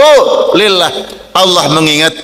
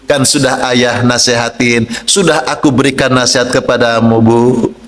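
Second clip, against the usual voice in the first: gentle speech; 7 semitones lower than usual. Shown as a demonstration. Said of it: "dengan bahasa yang lembut?".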